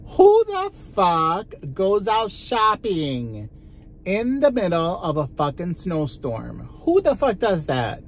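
mock-outraged at herself; incredulous rant. Real, not acted. Ranting about shopping in snowstorm.

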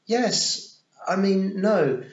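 high, loud, self-correcting; loud boyish stammered correction; flustered boy. A narrator's reading. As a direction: backtrack in a fluster. Boy flustered by her question.

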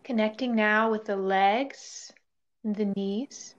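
calm body-scan voice with long pauses; guided meditation. Real, not acted. Yoga teacher leading listeners through a body scan, guided meditation. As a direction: guide them gently into stillness, leaving space between phrases.

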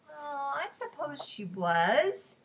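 slow, grudging admission; a touch of irritation; irritably. A narrator's reading. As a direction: drag it out in mild annoyance. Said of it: "Moshe answered irritably".

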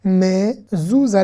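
"Mezouza" slowly as mé, zou, za; three separated, lengthened syllables in "Mezouza". Shown as a demonstration. Teacher sounding out a Hebrew word, syllable by syllable. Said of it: "on va faire consonne plus voyelle".

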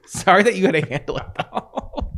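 words breaking into giggles; laughing apology. Real, not acted. Friend apologizing for leaving her with passengers, laughing. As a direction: say sorry through laughter.

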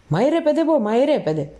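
high-pitched, hurried prodding; impatient, urgent command; urgent urging. A narrator's reading. Lookout urges his partner on.

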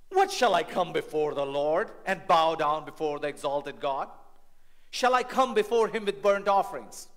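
loud, high, mocking plea; sarcastic lawyer. Defense lawyer mocking the Lord, loud sarcasm. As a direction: overdo every offer with sarcasm. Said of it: "very sarcastic".

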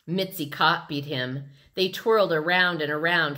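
ordinary delivery

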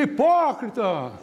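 high, drawn-out crowd call; imitated shouted request. Another fan calling for a bolero, imitated high shout. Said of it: "Um gritou lá".

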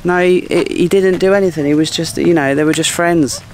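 mock-protest his innocence, a bit panicky; defensive friend. Friend defending the cheating husband to the wife, mock flustered. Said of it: "his friend for moral support who's like".

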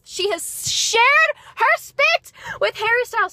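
loud, high, slow, giddy yelling; excited yelling. Real, not acted. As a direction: yell it out, unable to contain the excitement. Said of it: "Sorry, I'm yelling".

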